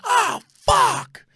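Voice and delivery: high, loud cry of dismay. Retold or imitated. Fan seeing his quarterback go down, high loud cry. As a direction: wail it in shock.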